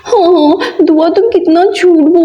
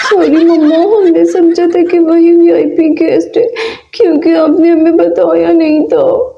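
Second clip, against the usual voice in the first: normal talking voice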